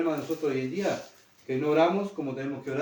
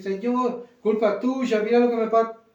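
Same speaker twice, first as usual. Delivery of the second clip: higher, louder accusing whine at the Lord; blaming complaint; 6 semitones higher, 4 dB louder than usual. Pretend. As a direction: throw the blame at God, pitched up. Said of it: "vamos a llorar y decir". (queja acusadora, aguda y fuerte)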